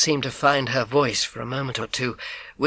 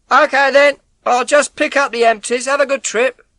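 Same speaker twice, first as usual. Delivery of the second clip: high, louder call through the door; milkman calling; 12 semitones higher, 8 dB louder than usual. Acted. Milkman calling through the front door, loud and concerned. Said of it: "I knew his voice".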